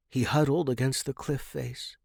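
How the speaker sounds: ordinary delivery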